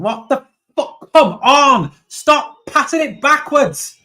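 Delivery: real fan rage at the screen; furious shout. Real, not acted. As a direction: scream at the players in frustration. Fan raging at backward passes.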